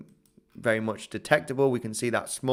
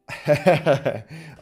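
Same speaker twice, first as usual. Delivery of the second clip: louder burst of chuckling; amused laugh; 2 semitones higher, 6 dB louder than usual. Real, not acted. Streamer reacting to a chat comment, amused laugh. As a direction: chuckle out loud in quick bursts.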